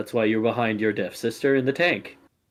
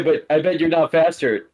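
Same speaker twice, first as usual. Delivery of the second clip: ordinary talk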